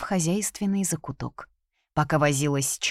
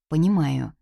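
plain reading voice

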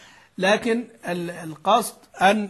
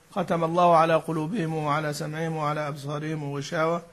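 Quran recitation; 4 semitones lower than usual. Acted. Teacher quoting verses in a light recitation style.

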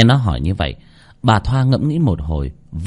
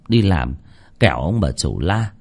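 ordinary delivery

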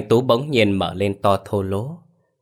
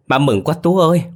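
breaking into joyful sobs, much higher and louder; sobbing with joy; 8 semitones higher, 6 dB louder than usual. A narrator's reading. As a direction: let the joy spill over into crying. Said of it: "bà mừng phát khóc lên". (khóc vì mừng, giọng cao và to)

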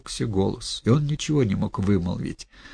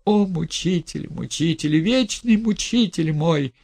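high, slow lament; anguished reproach; 8 semitones higher than usual. Acted. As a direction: wail at your tormentor.

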